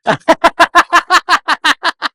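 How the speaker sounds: high, loud burst of laughter. Real, not acted. Laughs at own voice. (malakas at mataas na tawa)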